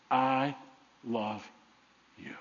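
hushed, word by word, fading low; tender declaration. Acted. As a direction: let each word sink in softly. Voices God's love, word by word.

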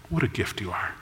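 hushed loving echo. Acted. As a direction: let the repeat sink almost to a murmur. Groom repeats praise, softer.

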